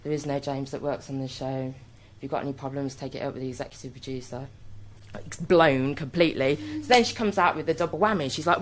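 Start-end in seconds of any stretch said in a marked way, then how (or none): 0.0-4.5 low, soft, dismissive brush-off; a hint of a bored young researcher; flat researcher. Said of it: "researcher turned around and went".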